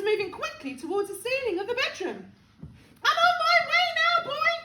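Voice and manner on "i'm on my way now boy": fast, high, loud holler; gleeful call down. A narrator's reading. Grandma calling down to George, gleeful call. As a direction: holler down to the boy. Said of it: "she called down to George".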